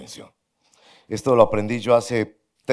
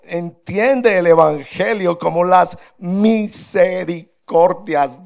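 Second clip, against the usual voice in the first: higher, louder, slow earnest plea; pleading Paul; 8 semitones higher, 8 dB louder, slower than usual. Retold or imitated. Paul pleading with the church, higher, louder, slow, earnest. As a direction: beg the listeners like the apostle. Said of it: "Pablo ruega".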